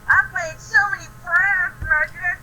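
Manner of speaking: high, loud excited gush; gushing to a friend, excited; excited squeal. Real, not acted. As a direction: burst out with glee.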